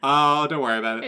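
loud, quick mock dismissal; breezy brush-off. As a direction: wave it away like it's nothing. Acted scene, corporate guy answers.

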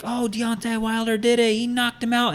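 high, thrilled play-by-play call; excited commentator. Retold or imitated. Mimicking the announcer calling the knockout, excited. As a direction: imitate an announcer losing it. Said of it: "there's excitement in his voice".

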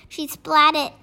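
usual speaking voice

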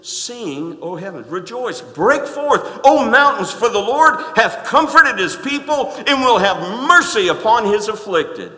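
loud, high, ringing scripture proclamation; booming pulpit reading of the verse; preaching at full force. Acted. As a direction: proclaim it to the rafters.

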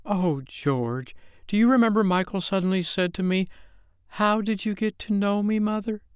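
high, lilting mother's voice; tender recollection of a child's question; wistful mother. A narrator's reading. Mother fondly recalls her lost child.

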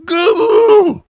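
high, loud, drawn-out kiddie wail; tantrum squeal. Retold or imitated. Little kid stomping his feet in a temper tantrum, mock squeal. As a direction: mimic a kid's freaked-out squeal. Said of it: "throws a complete freaked out temper tantrum".

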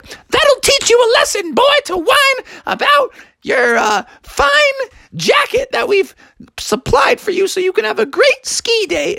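very high falsetto mother; scolding mom. Acted. Mom lectures Froggy.

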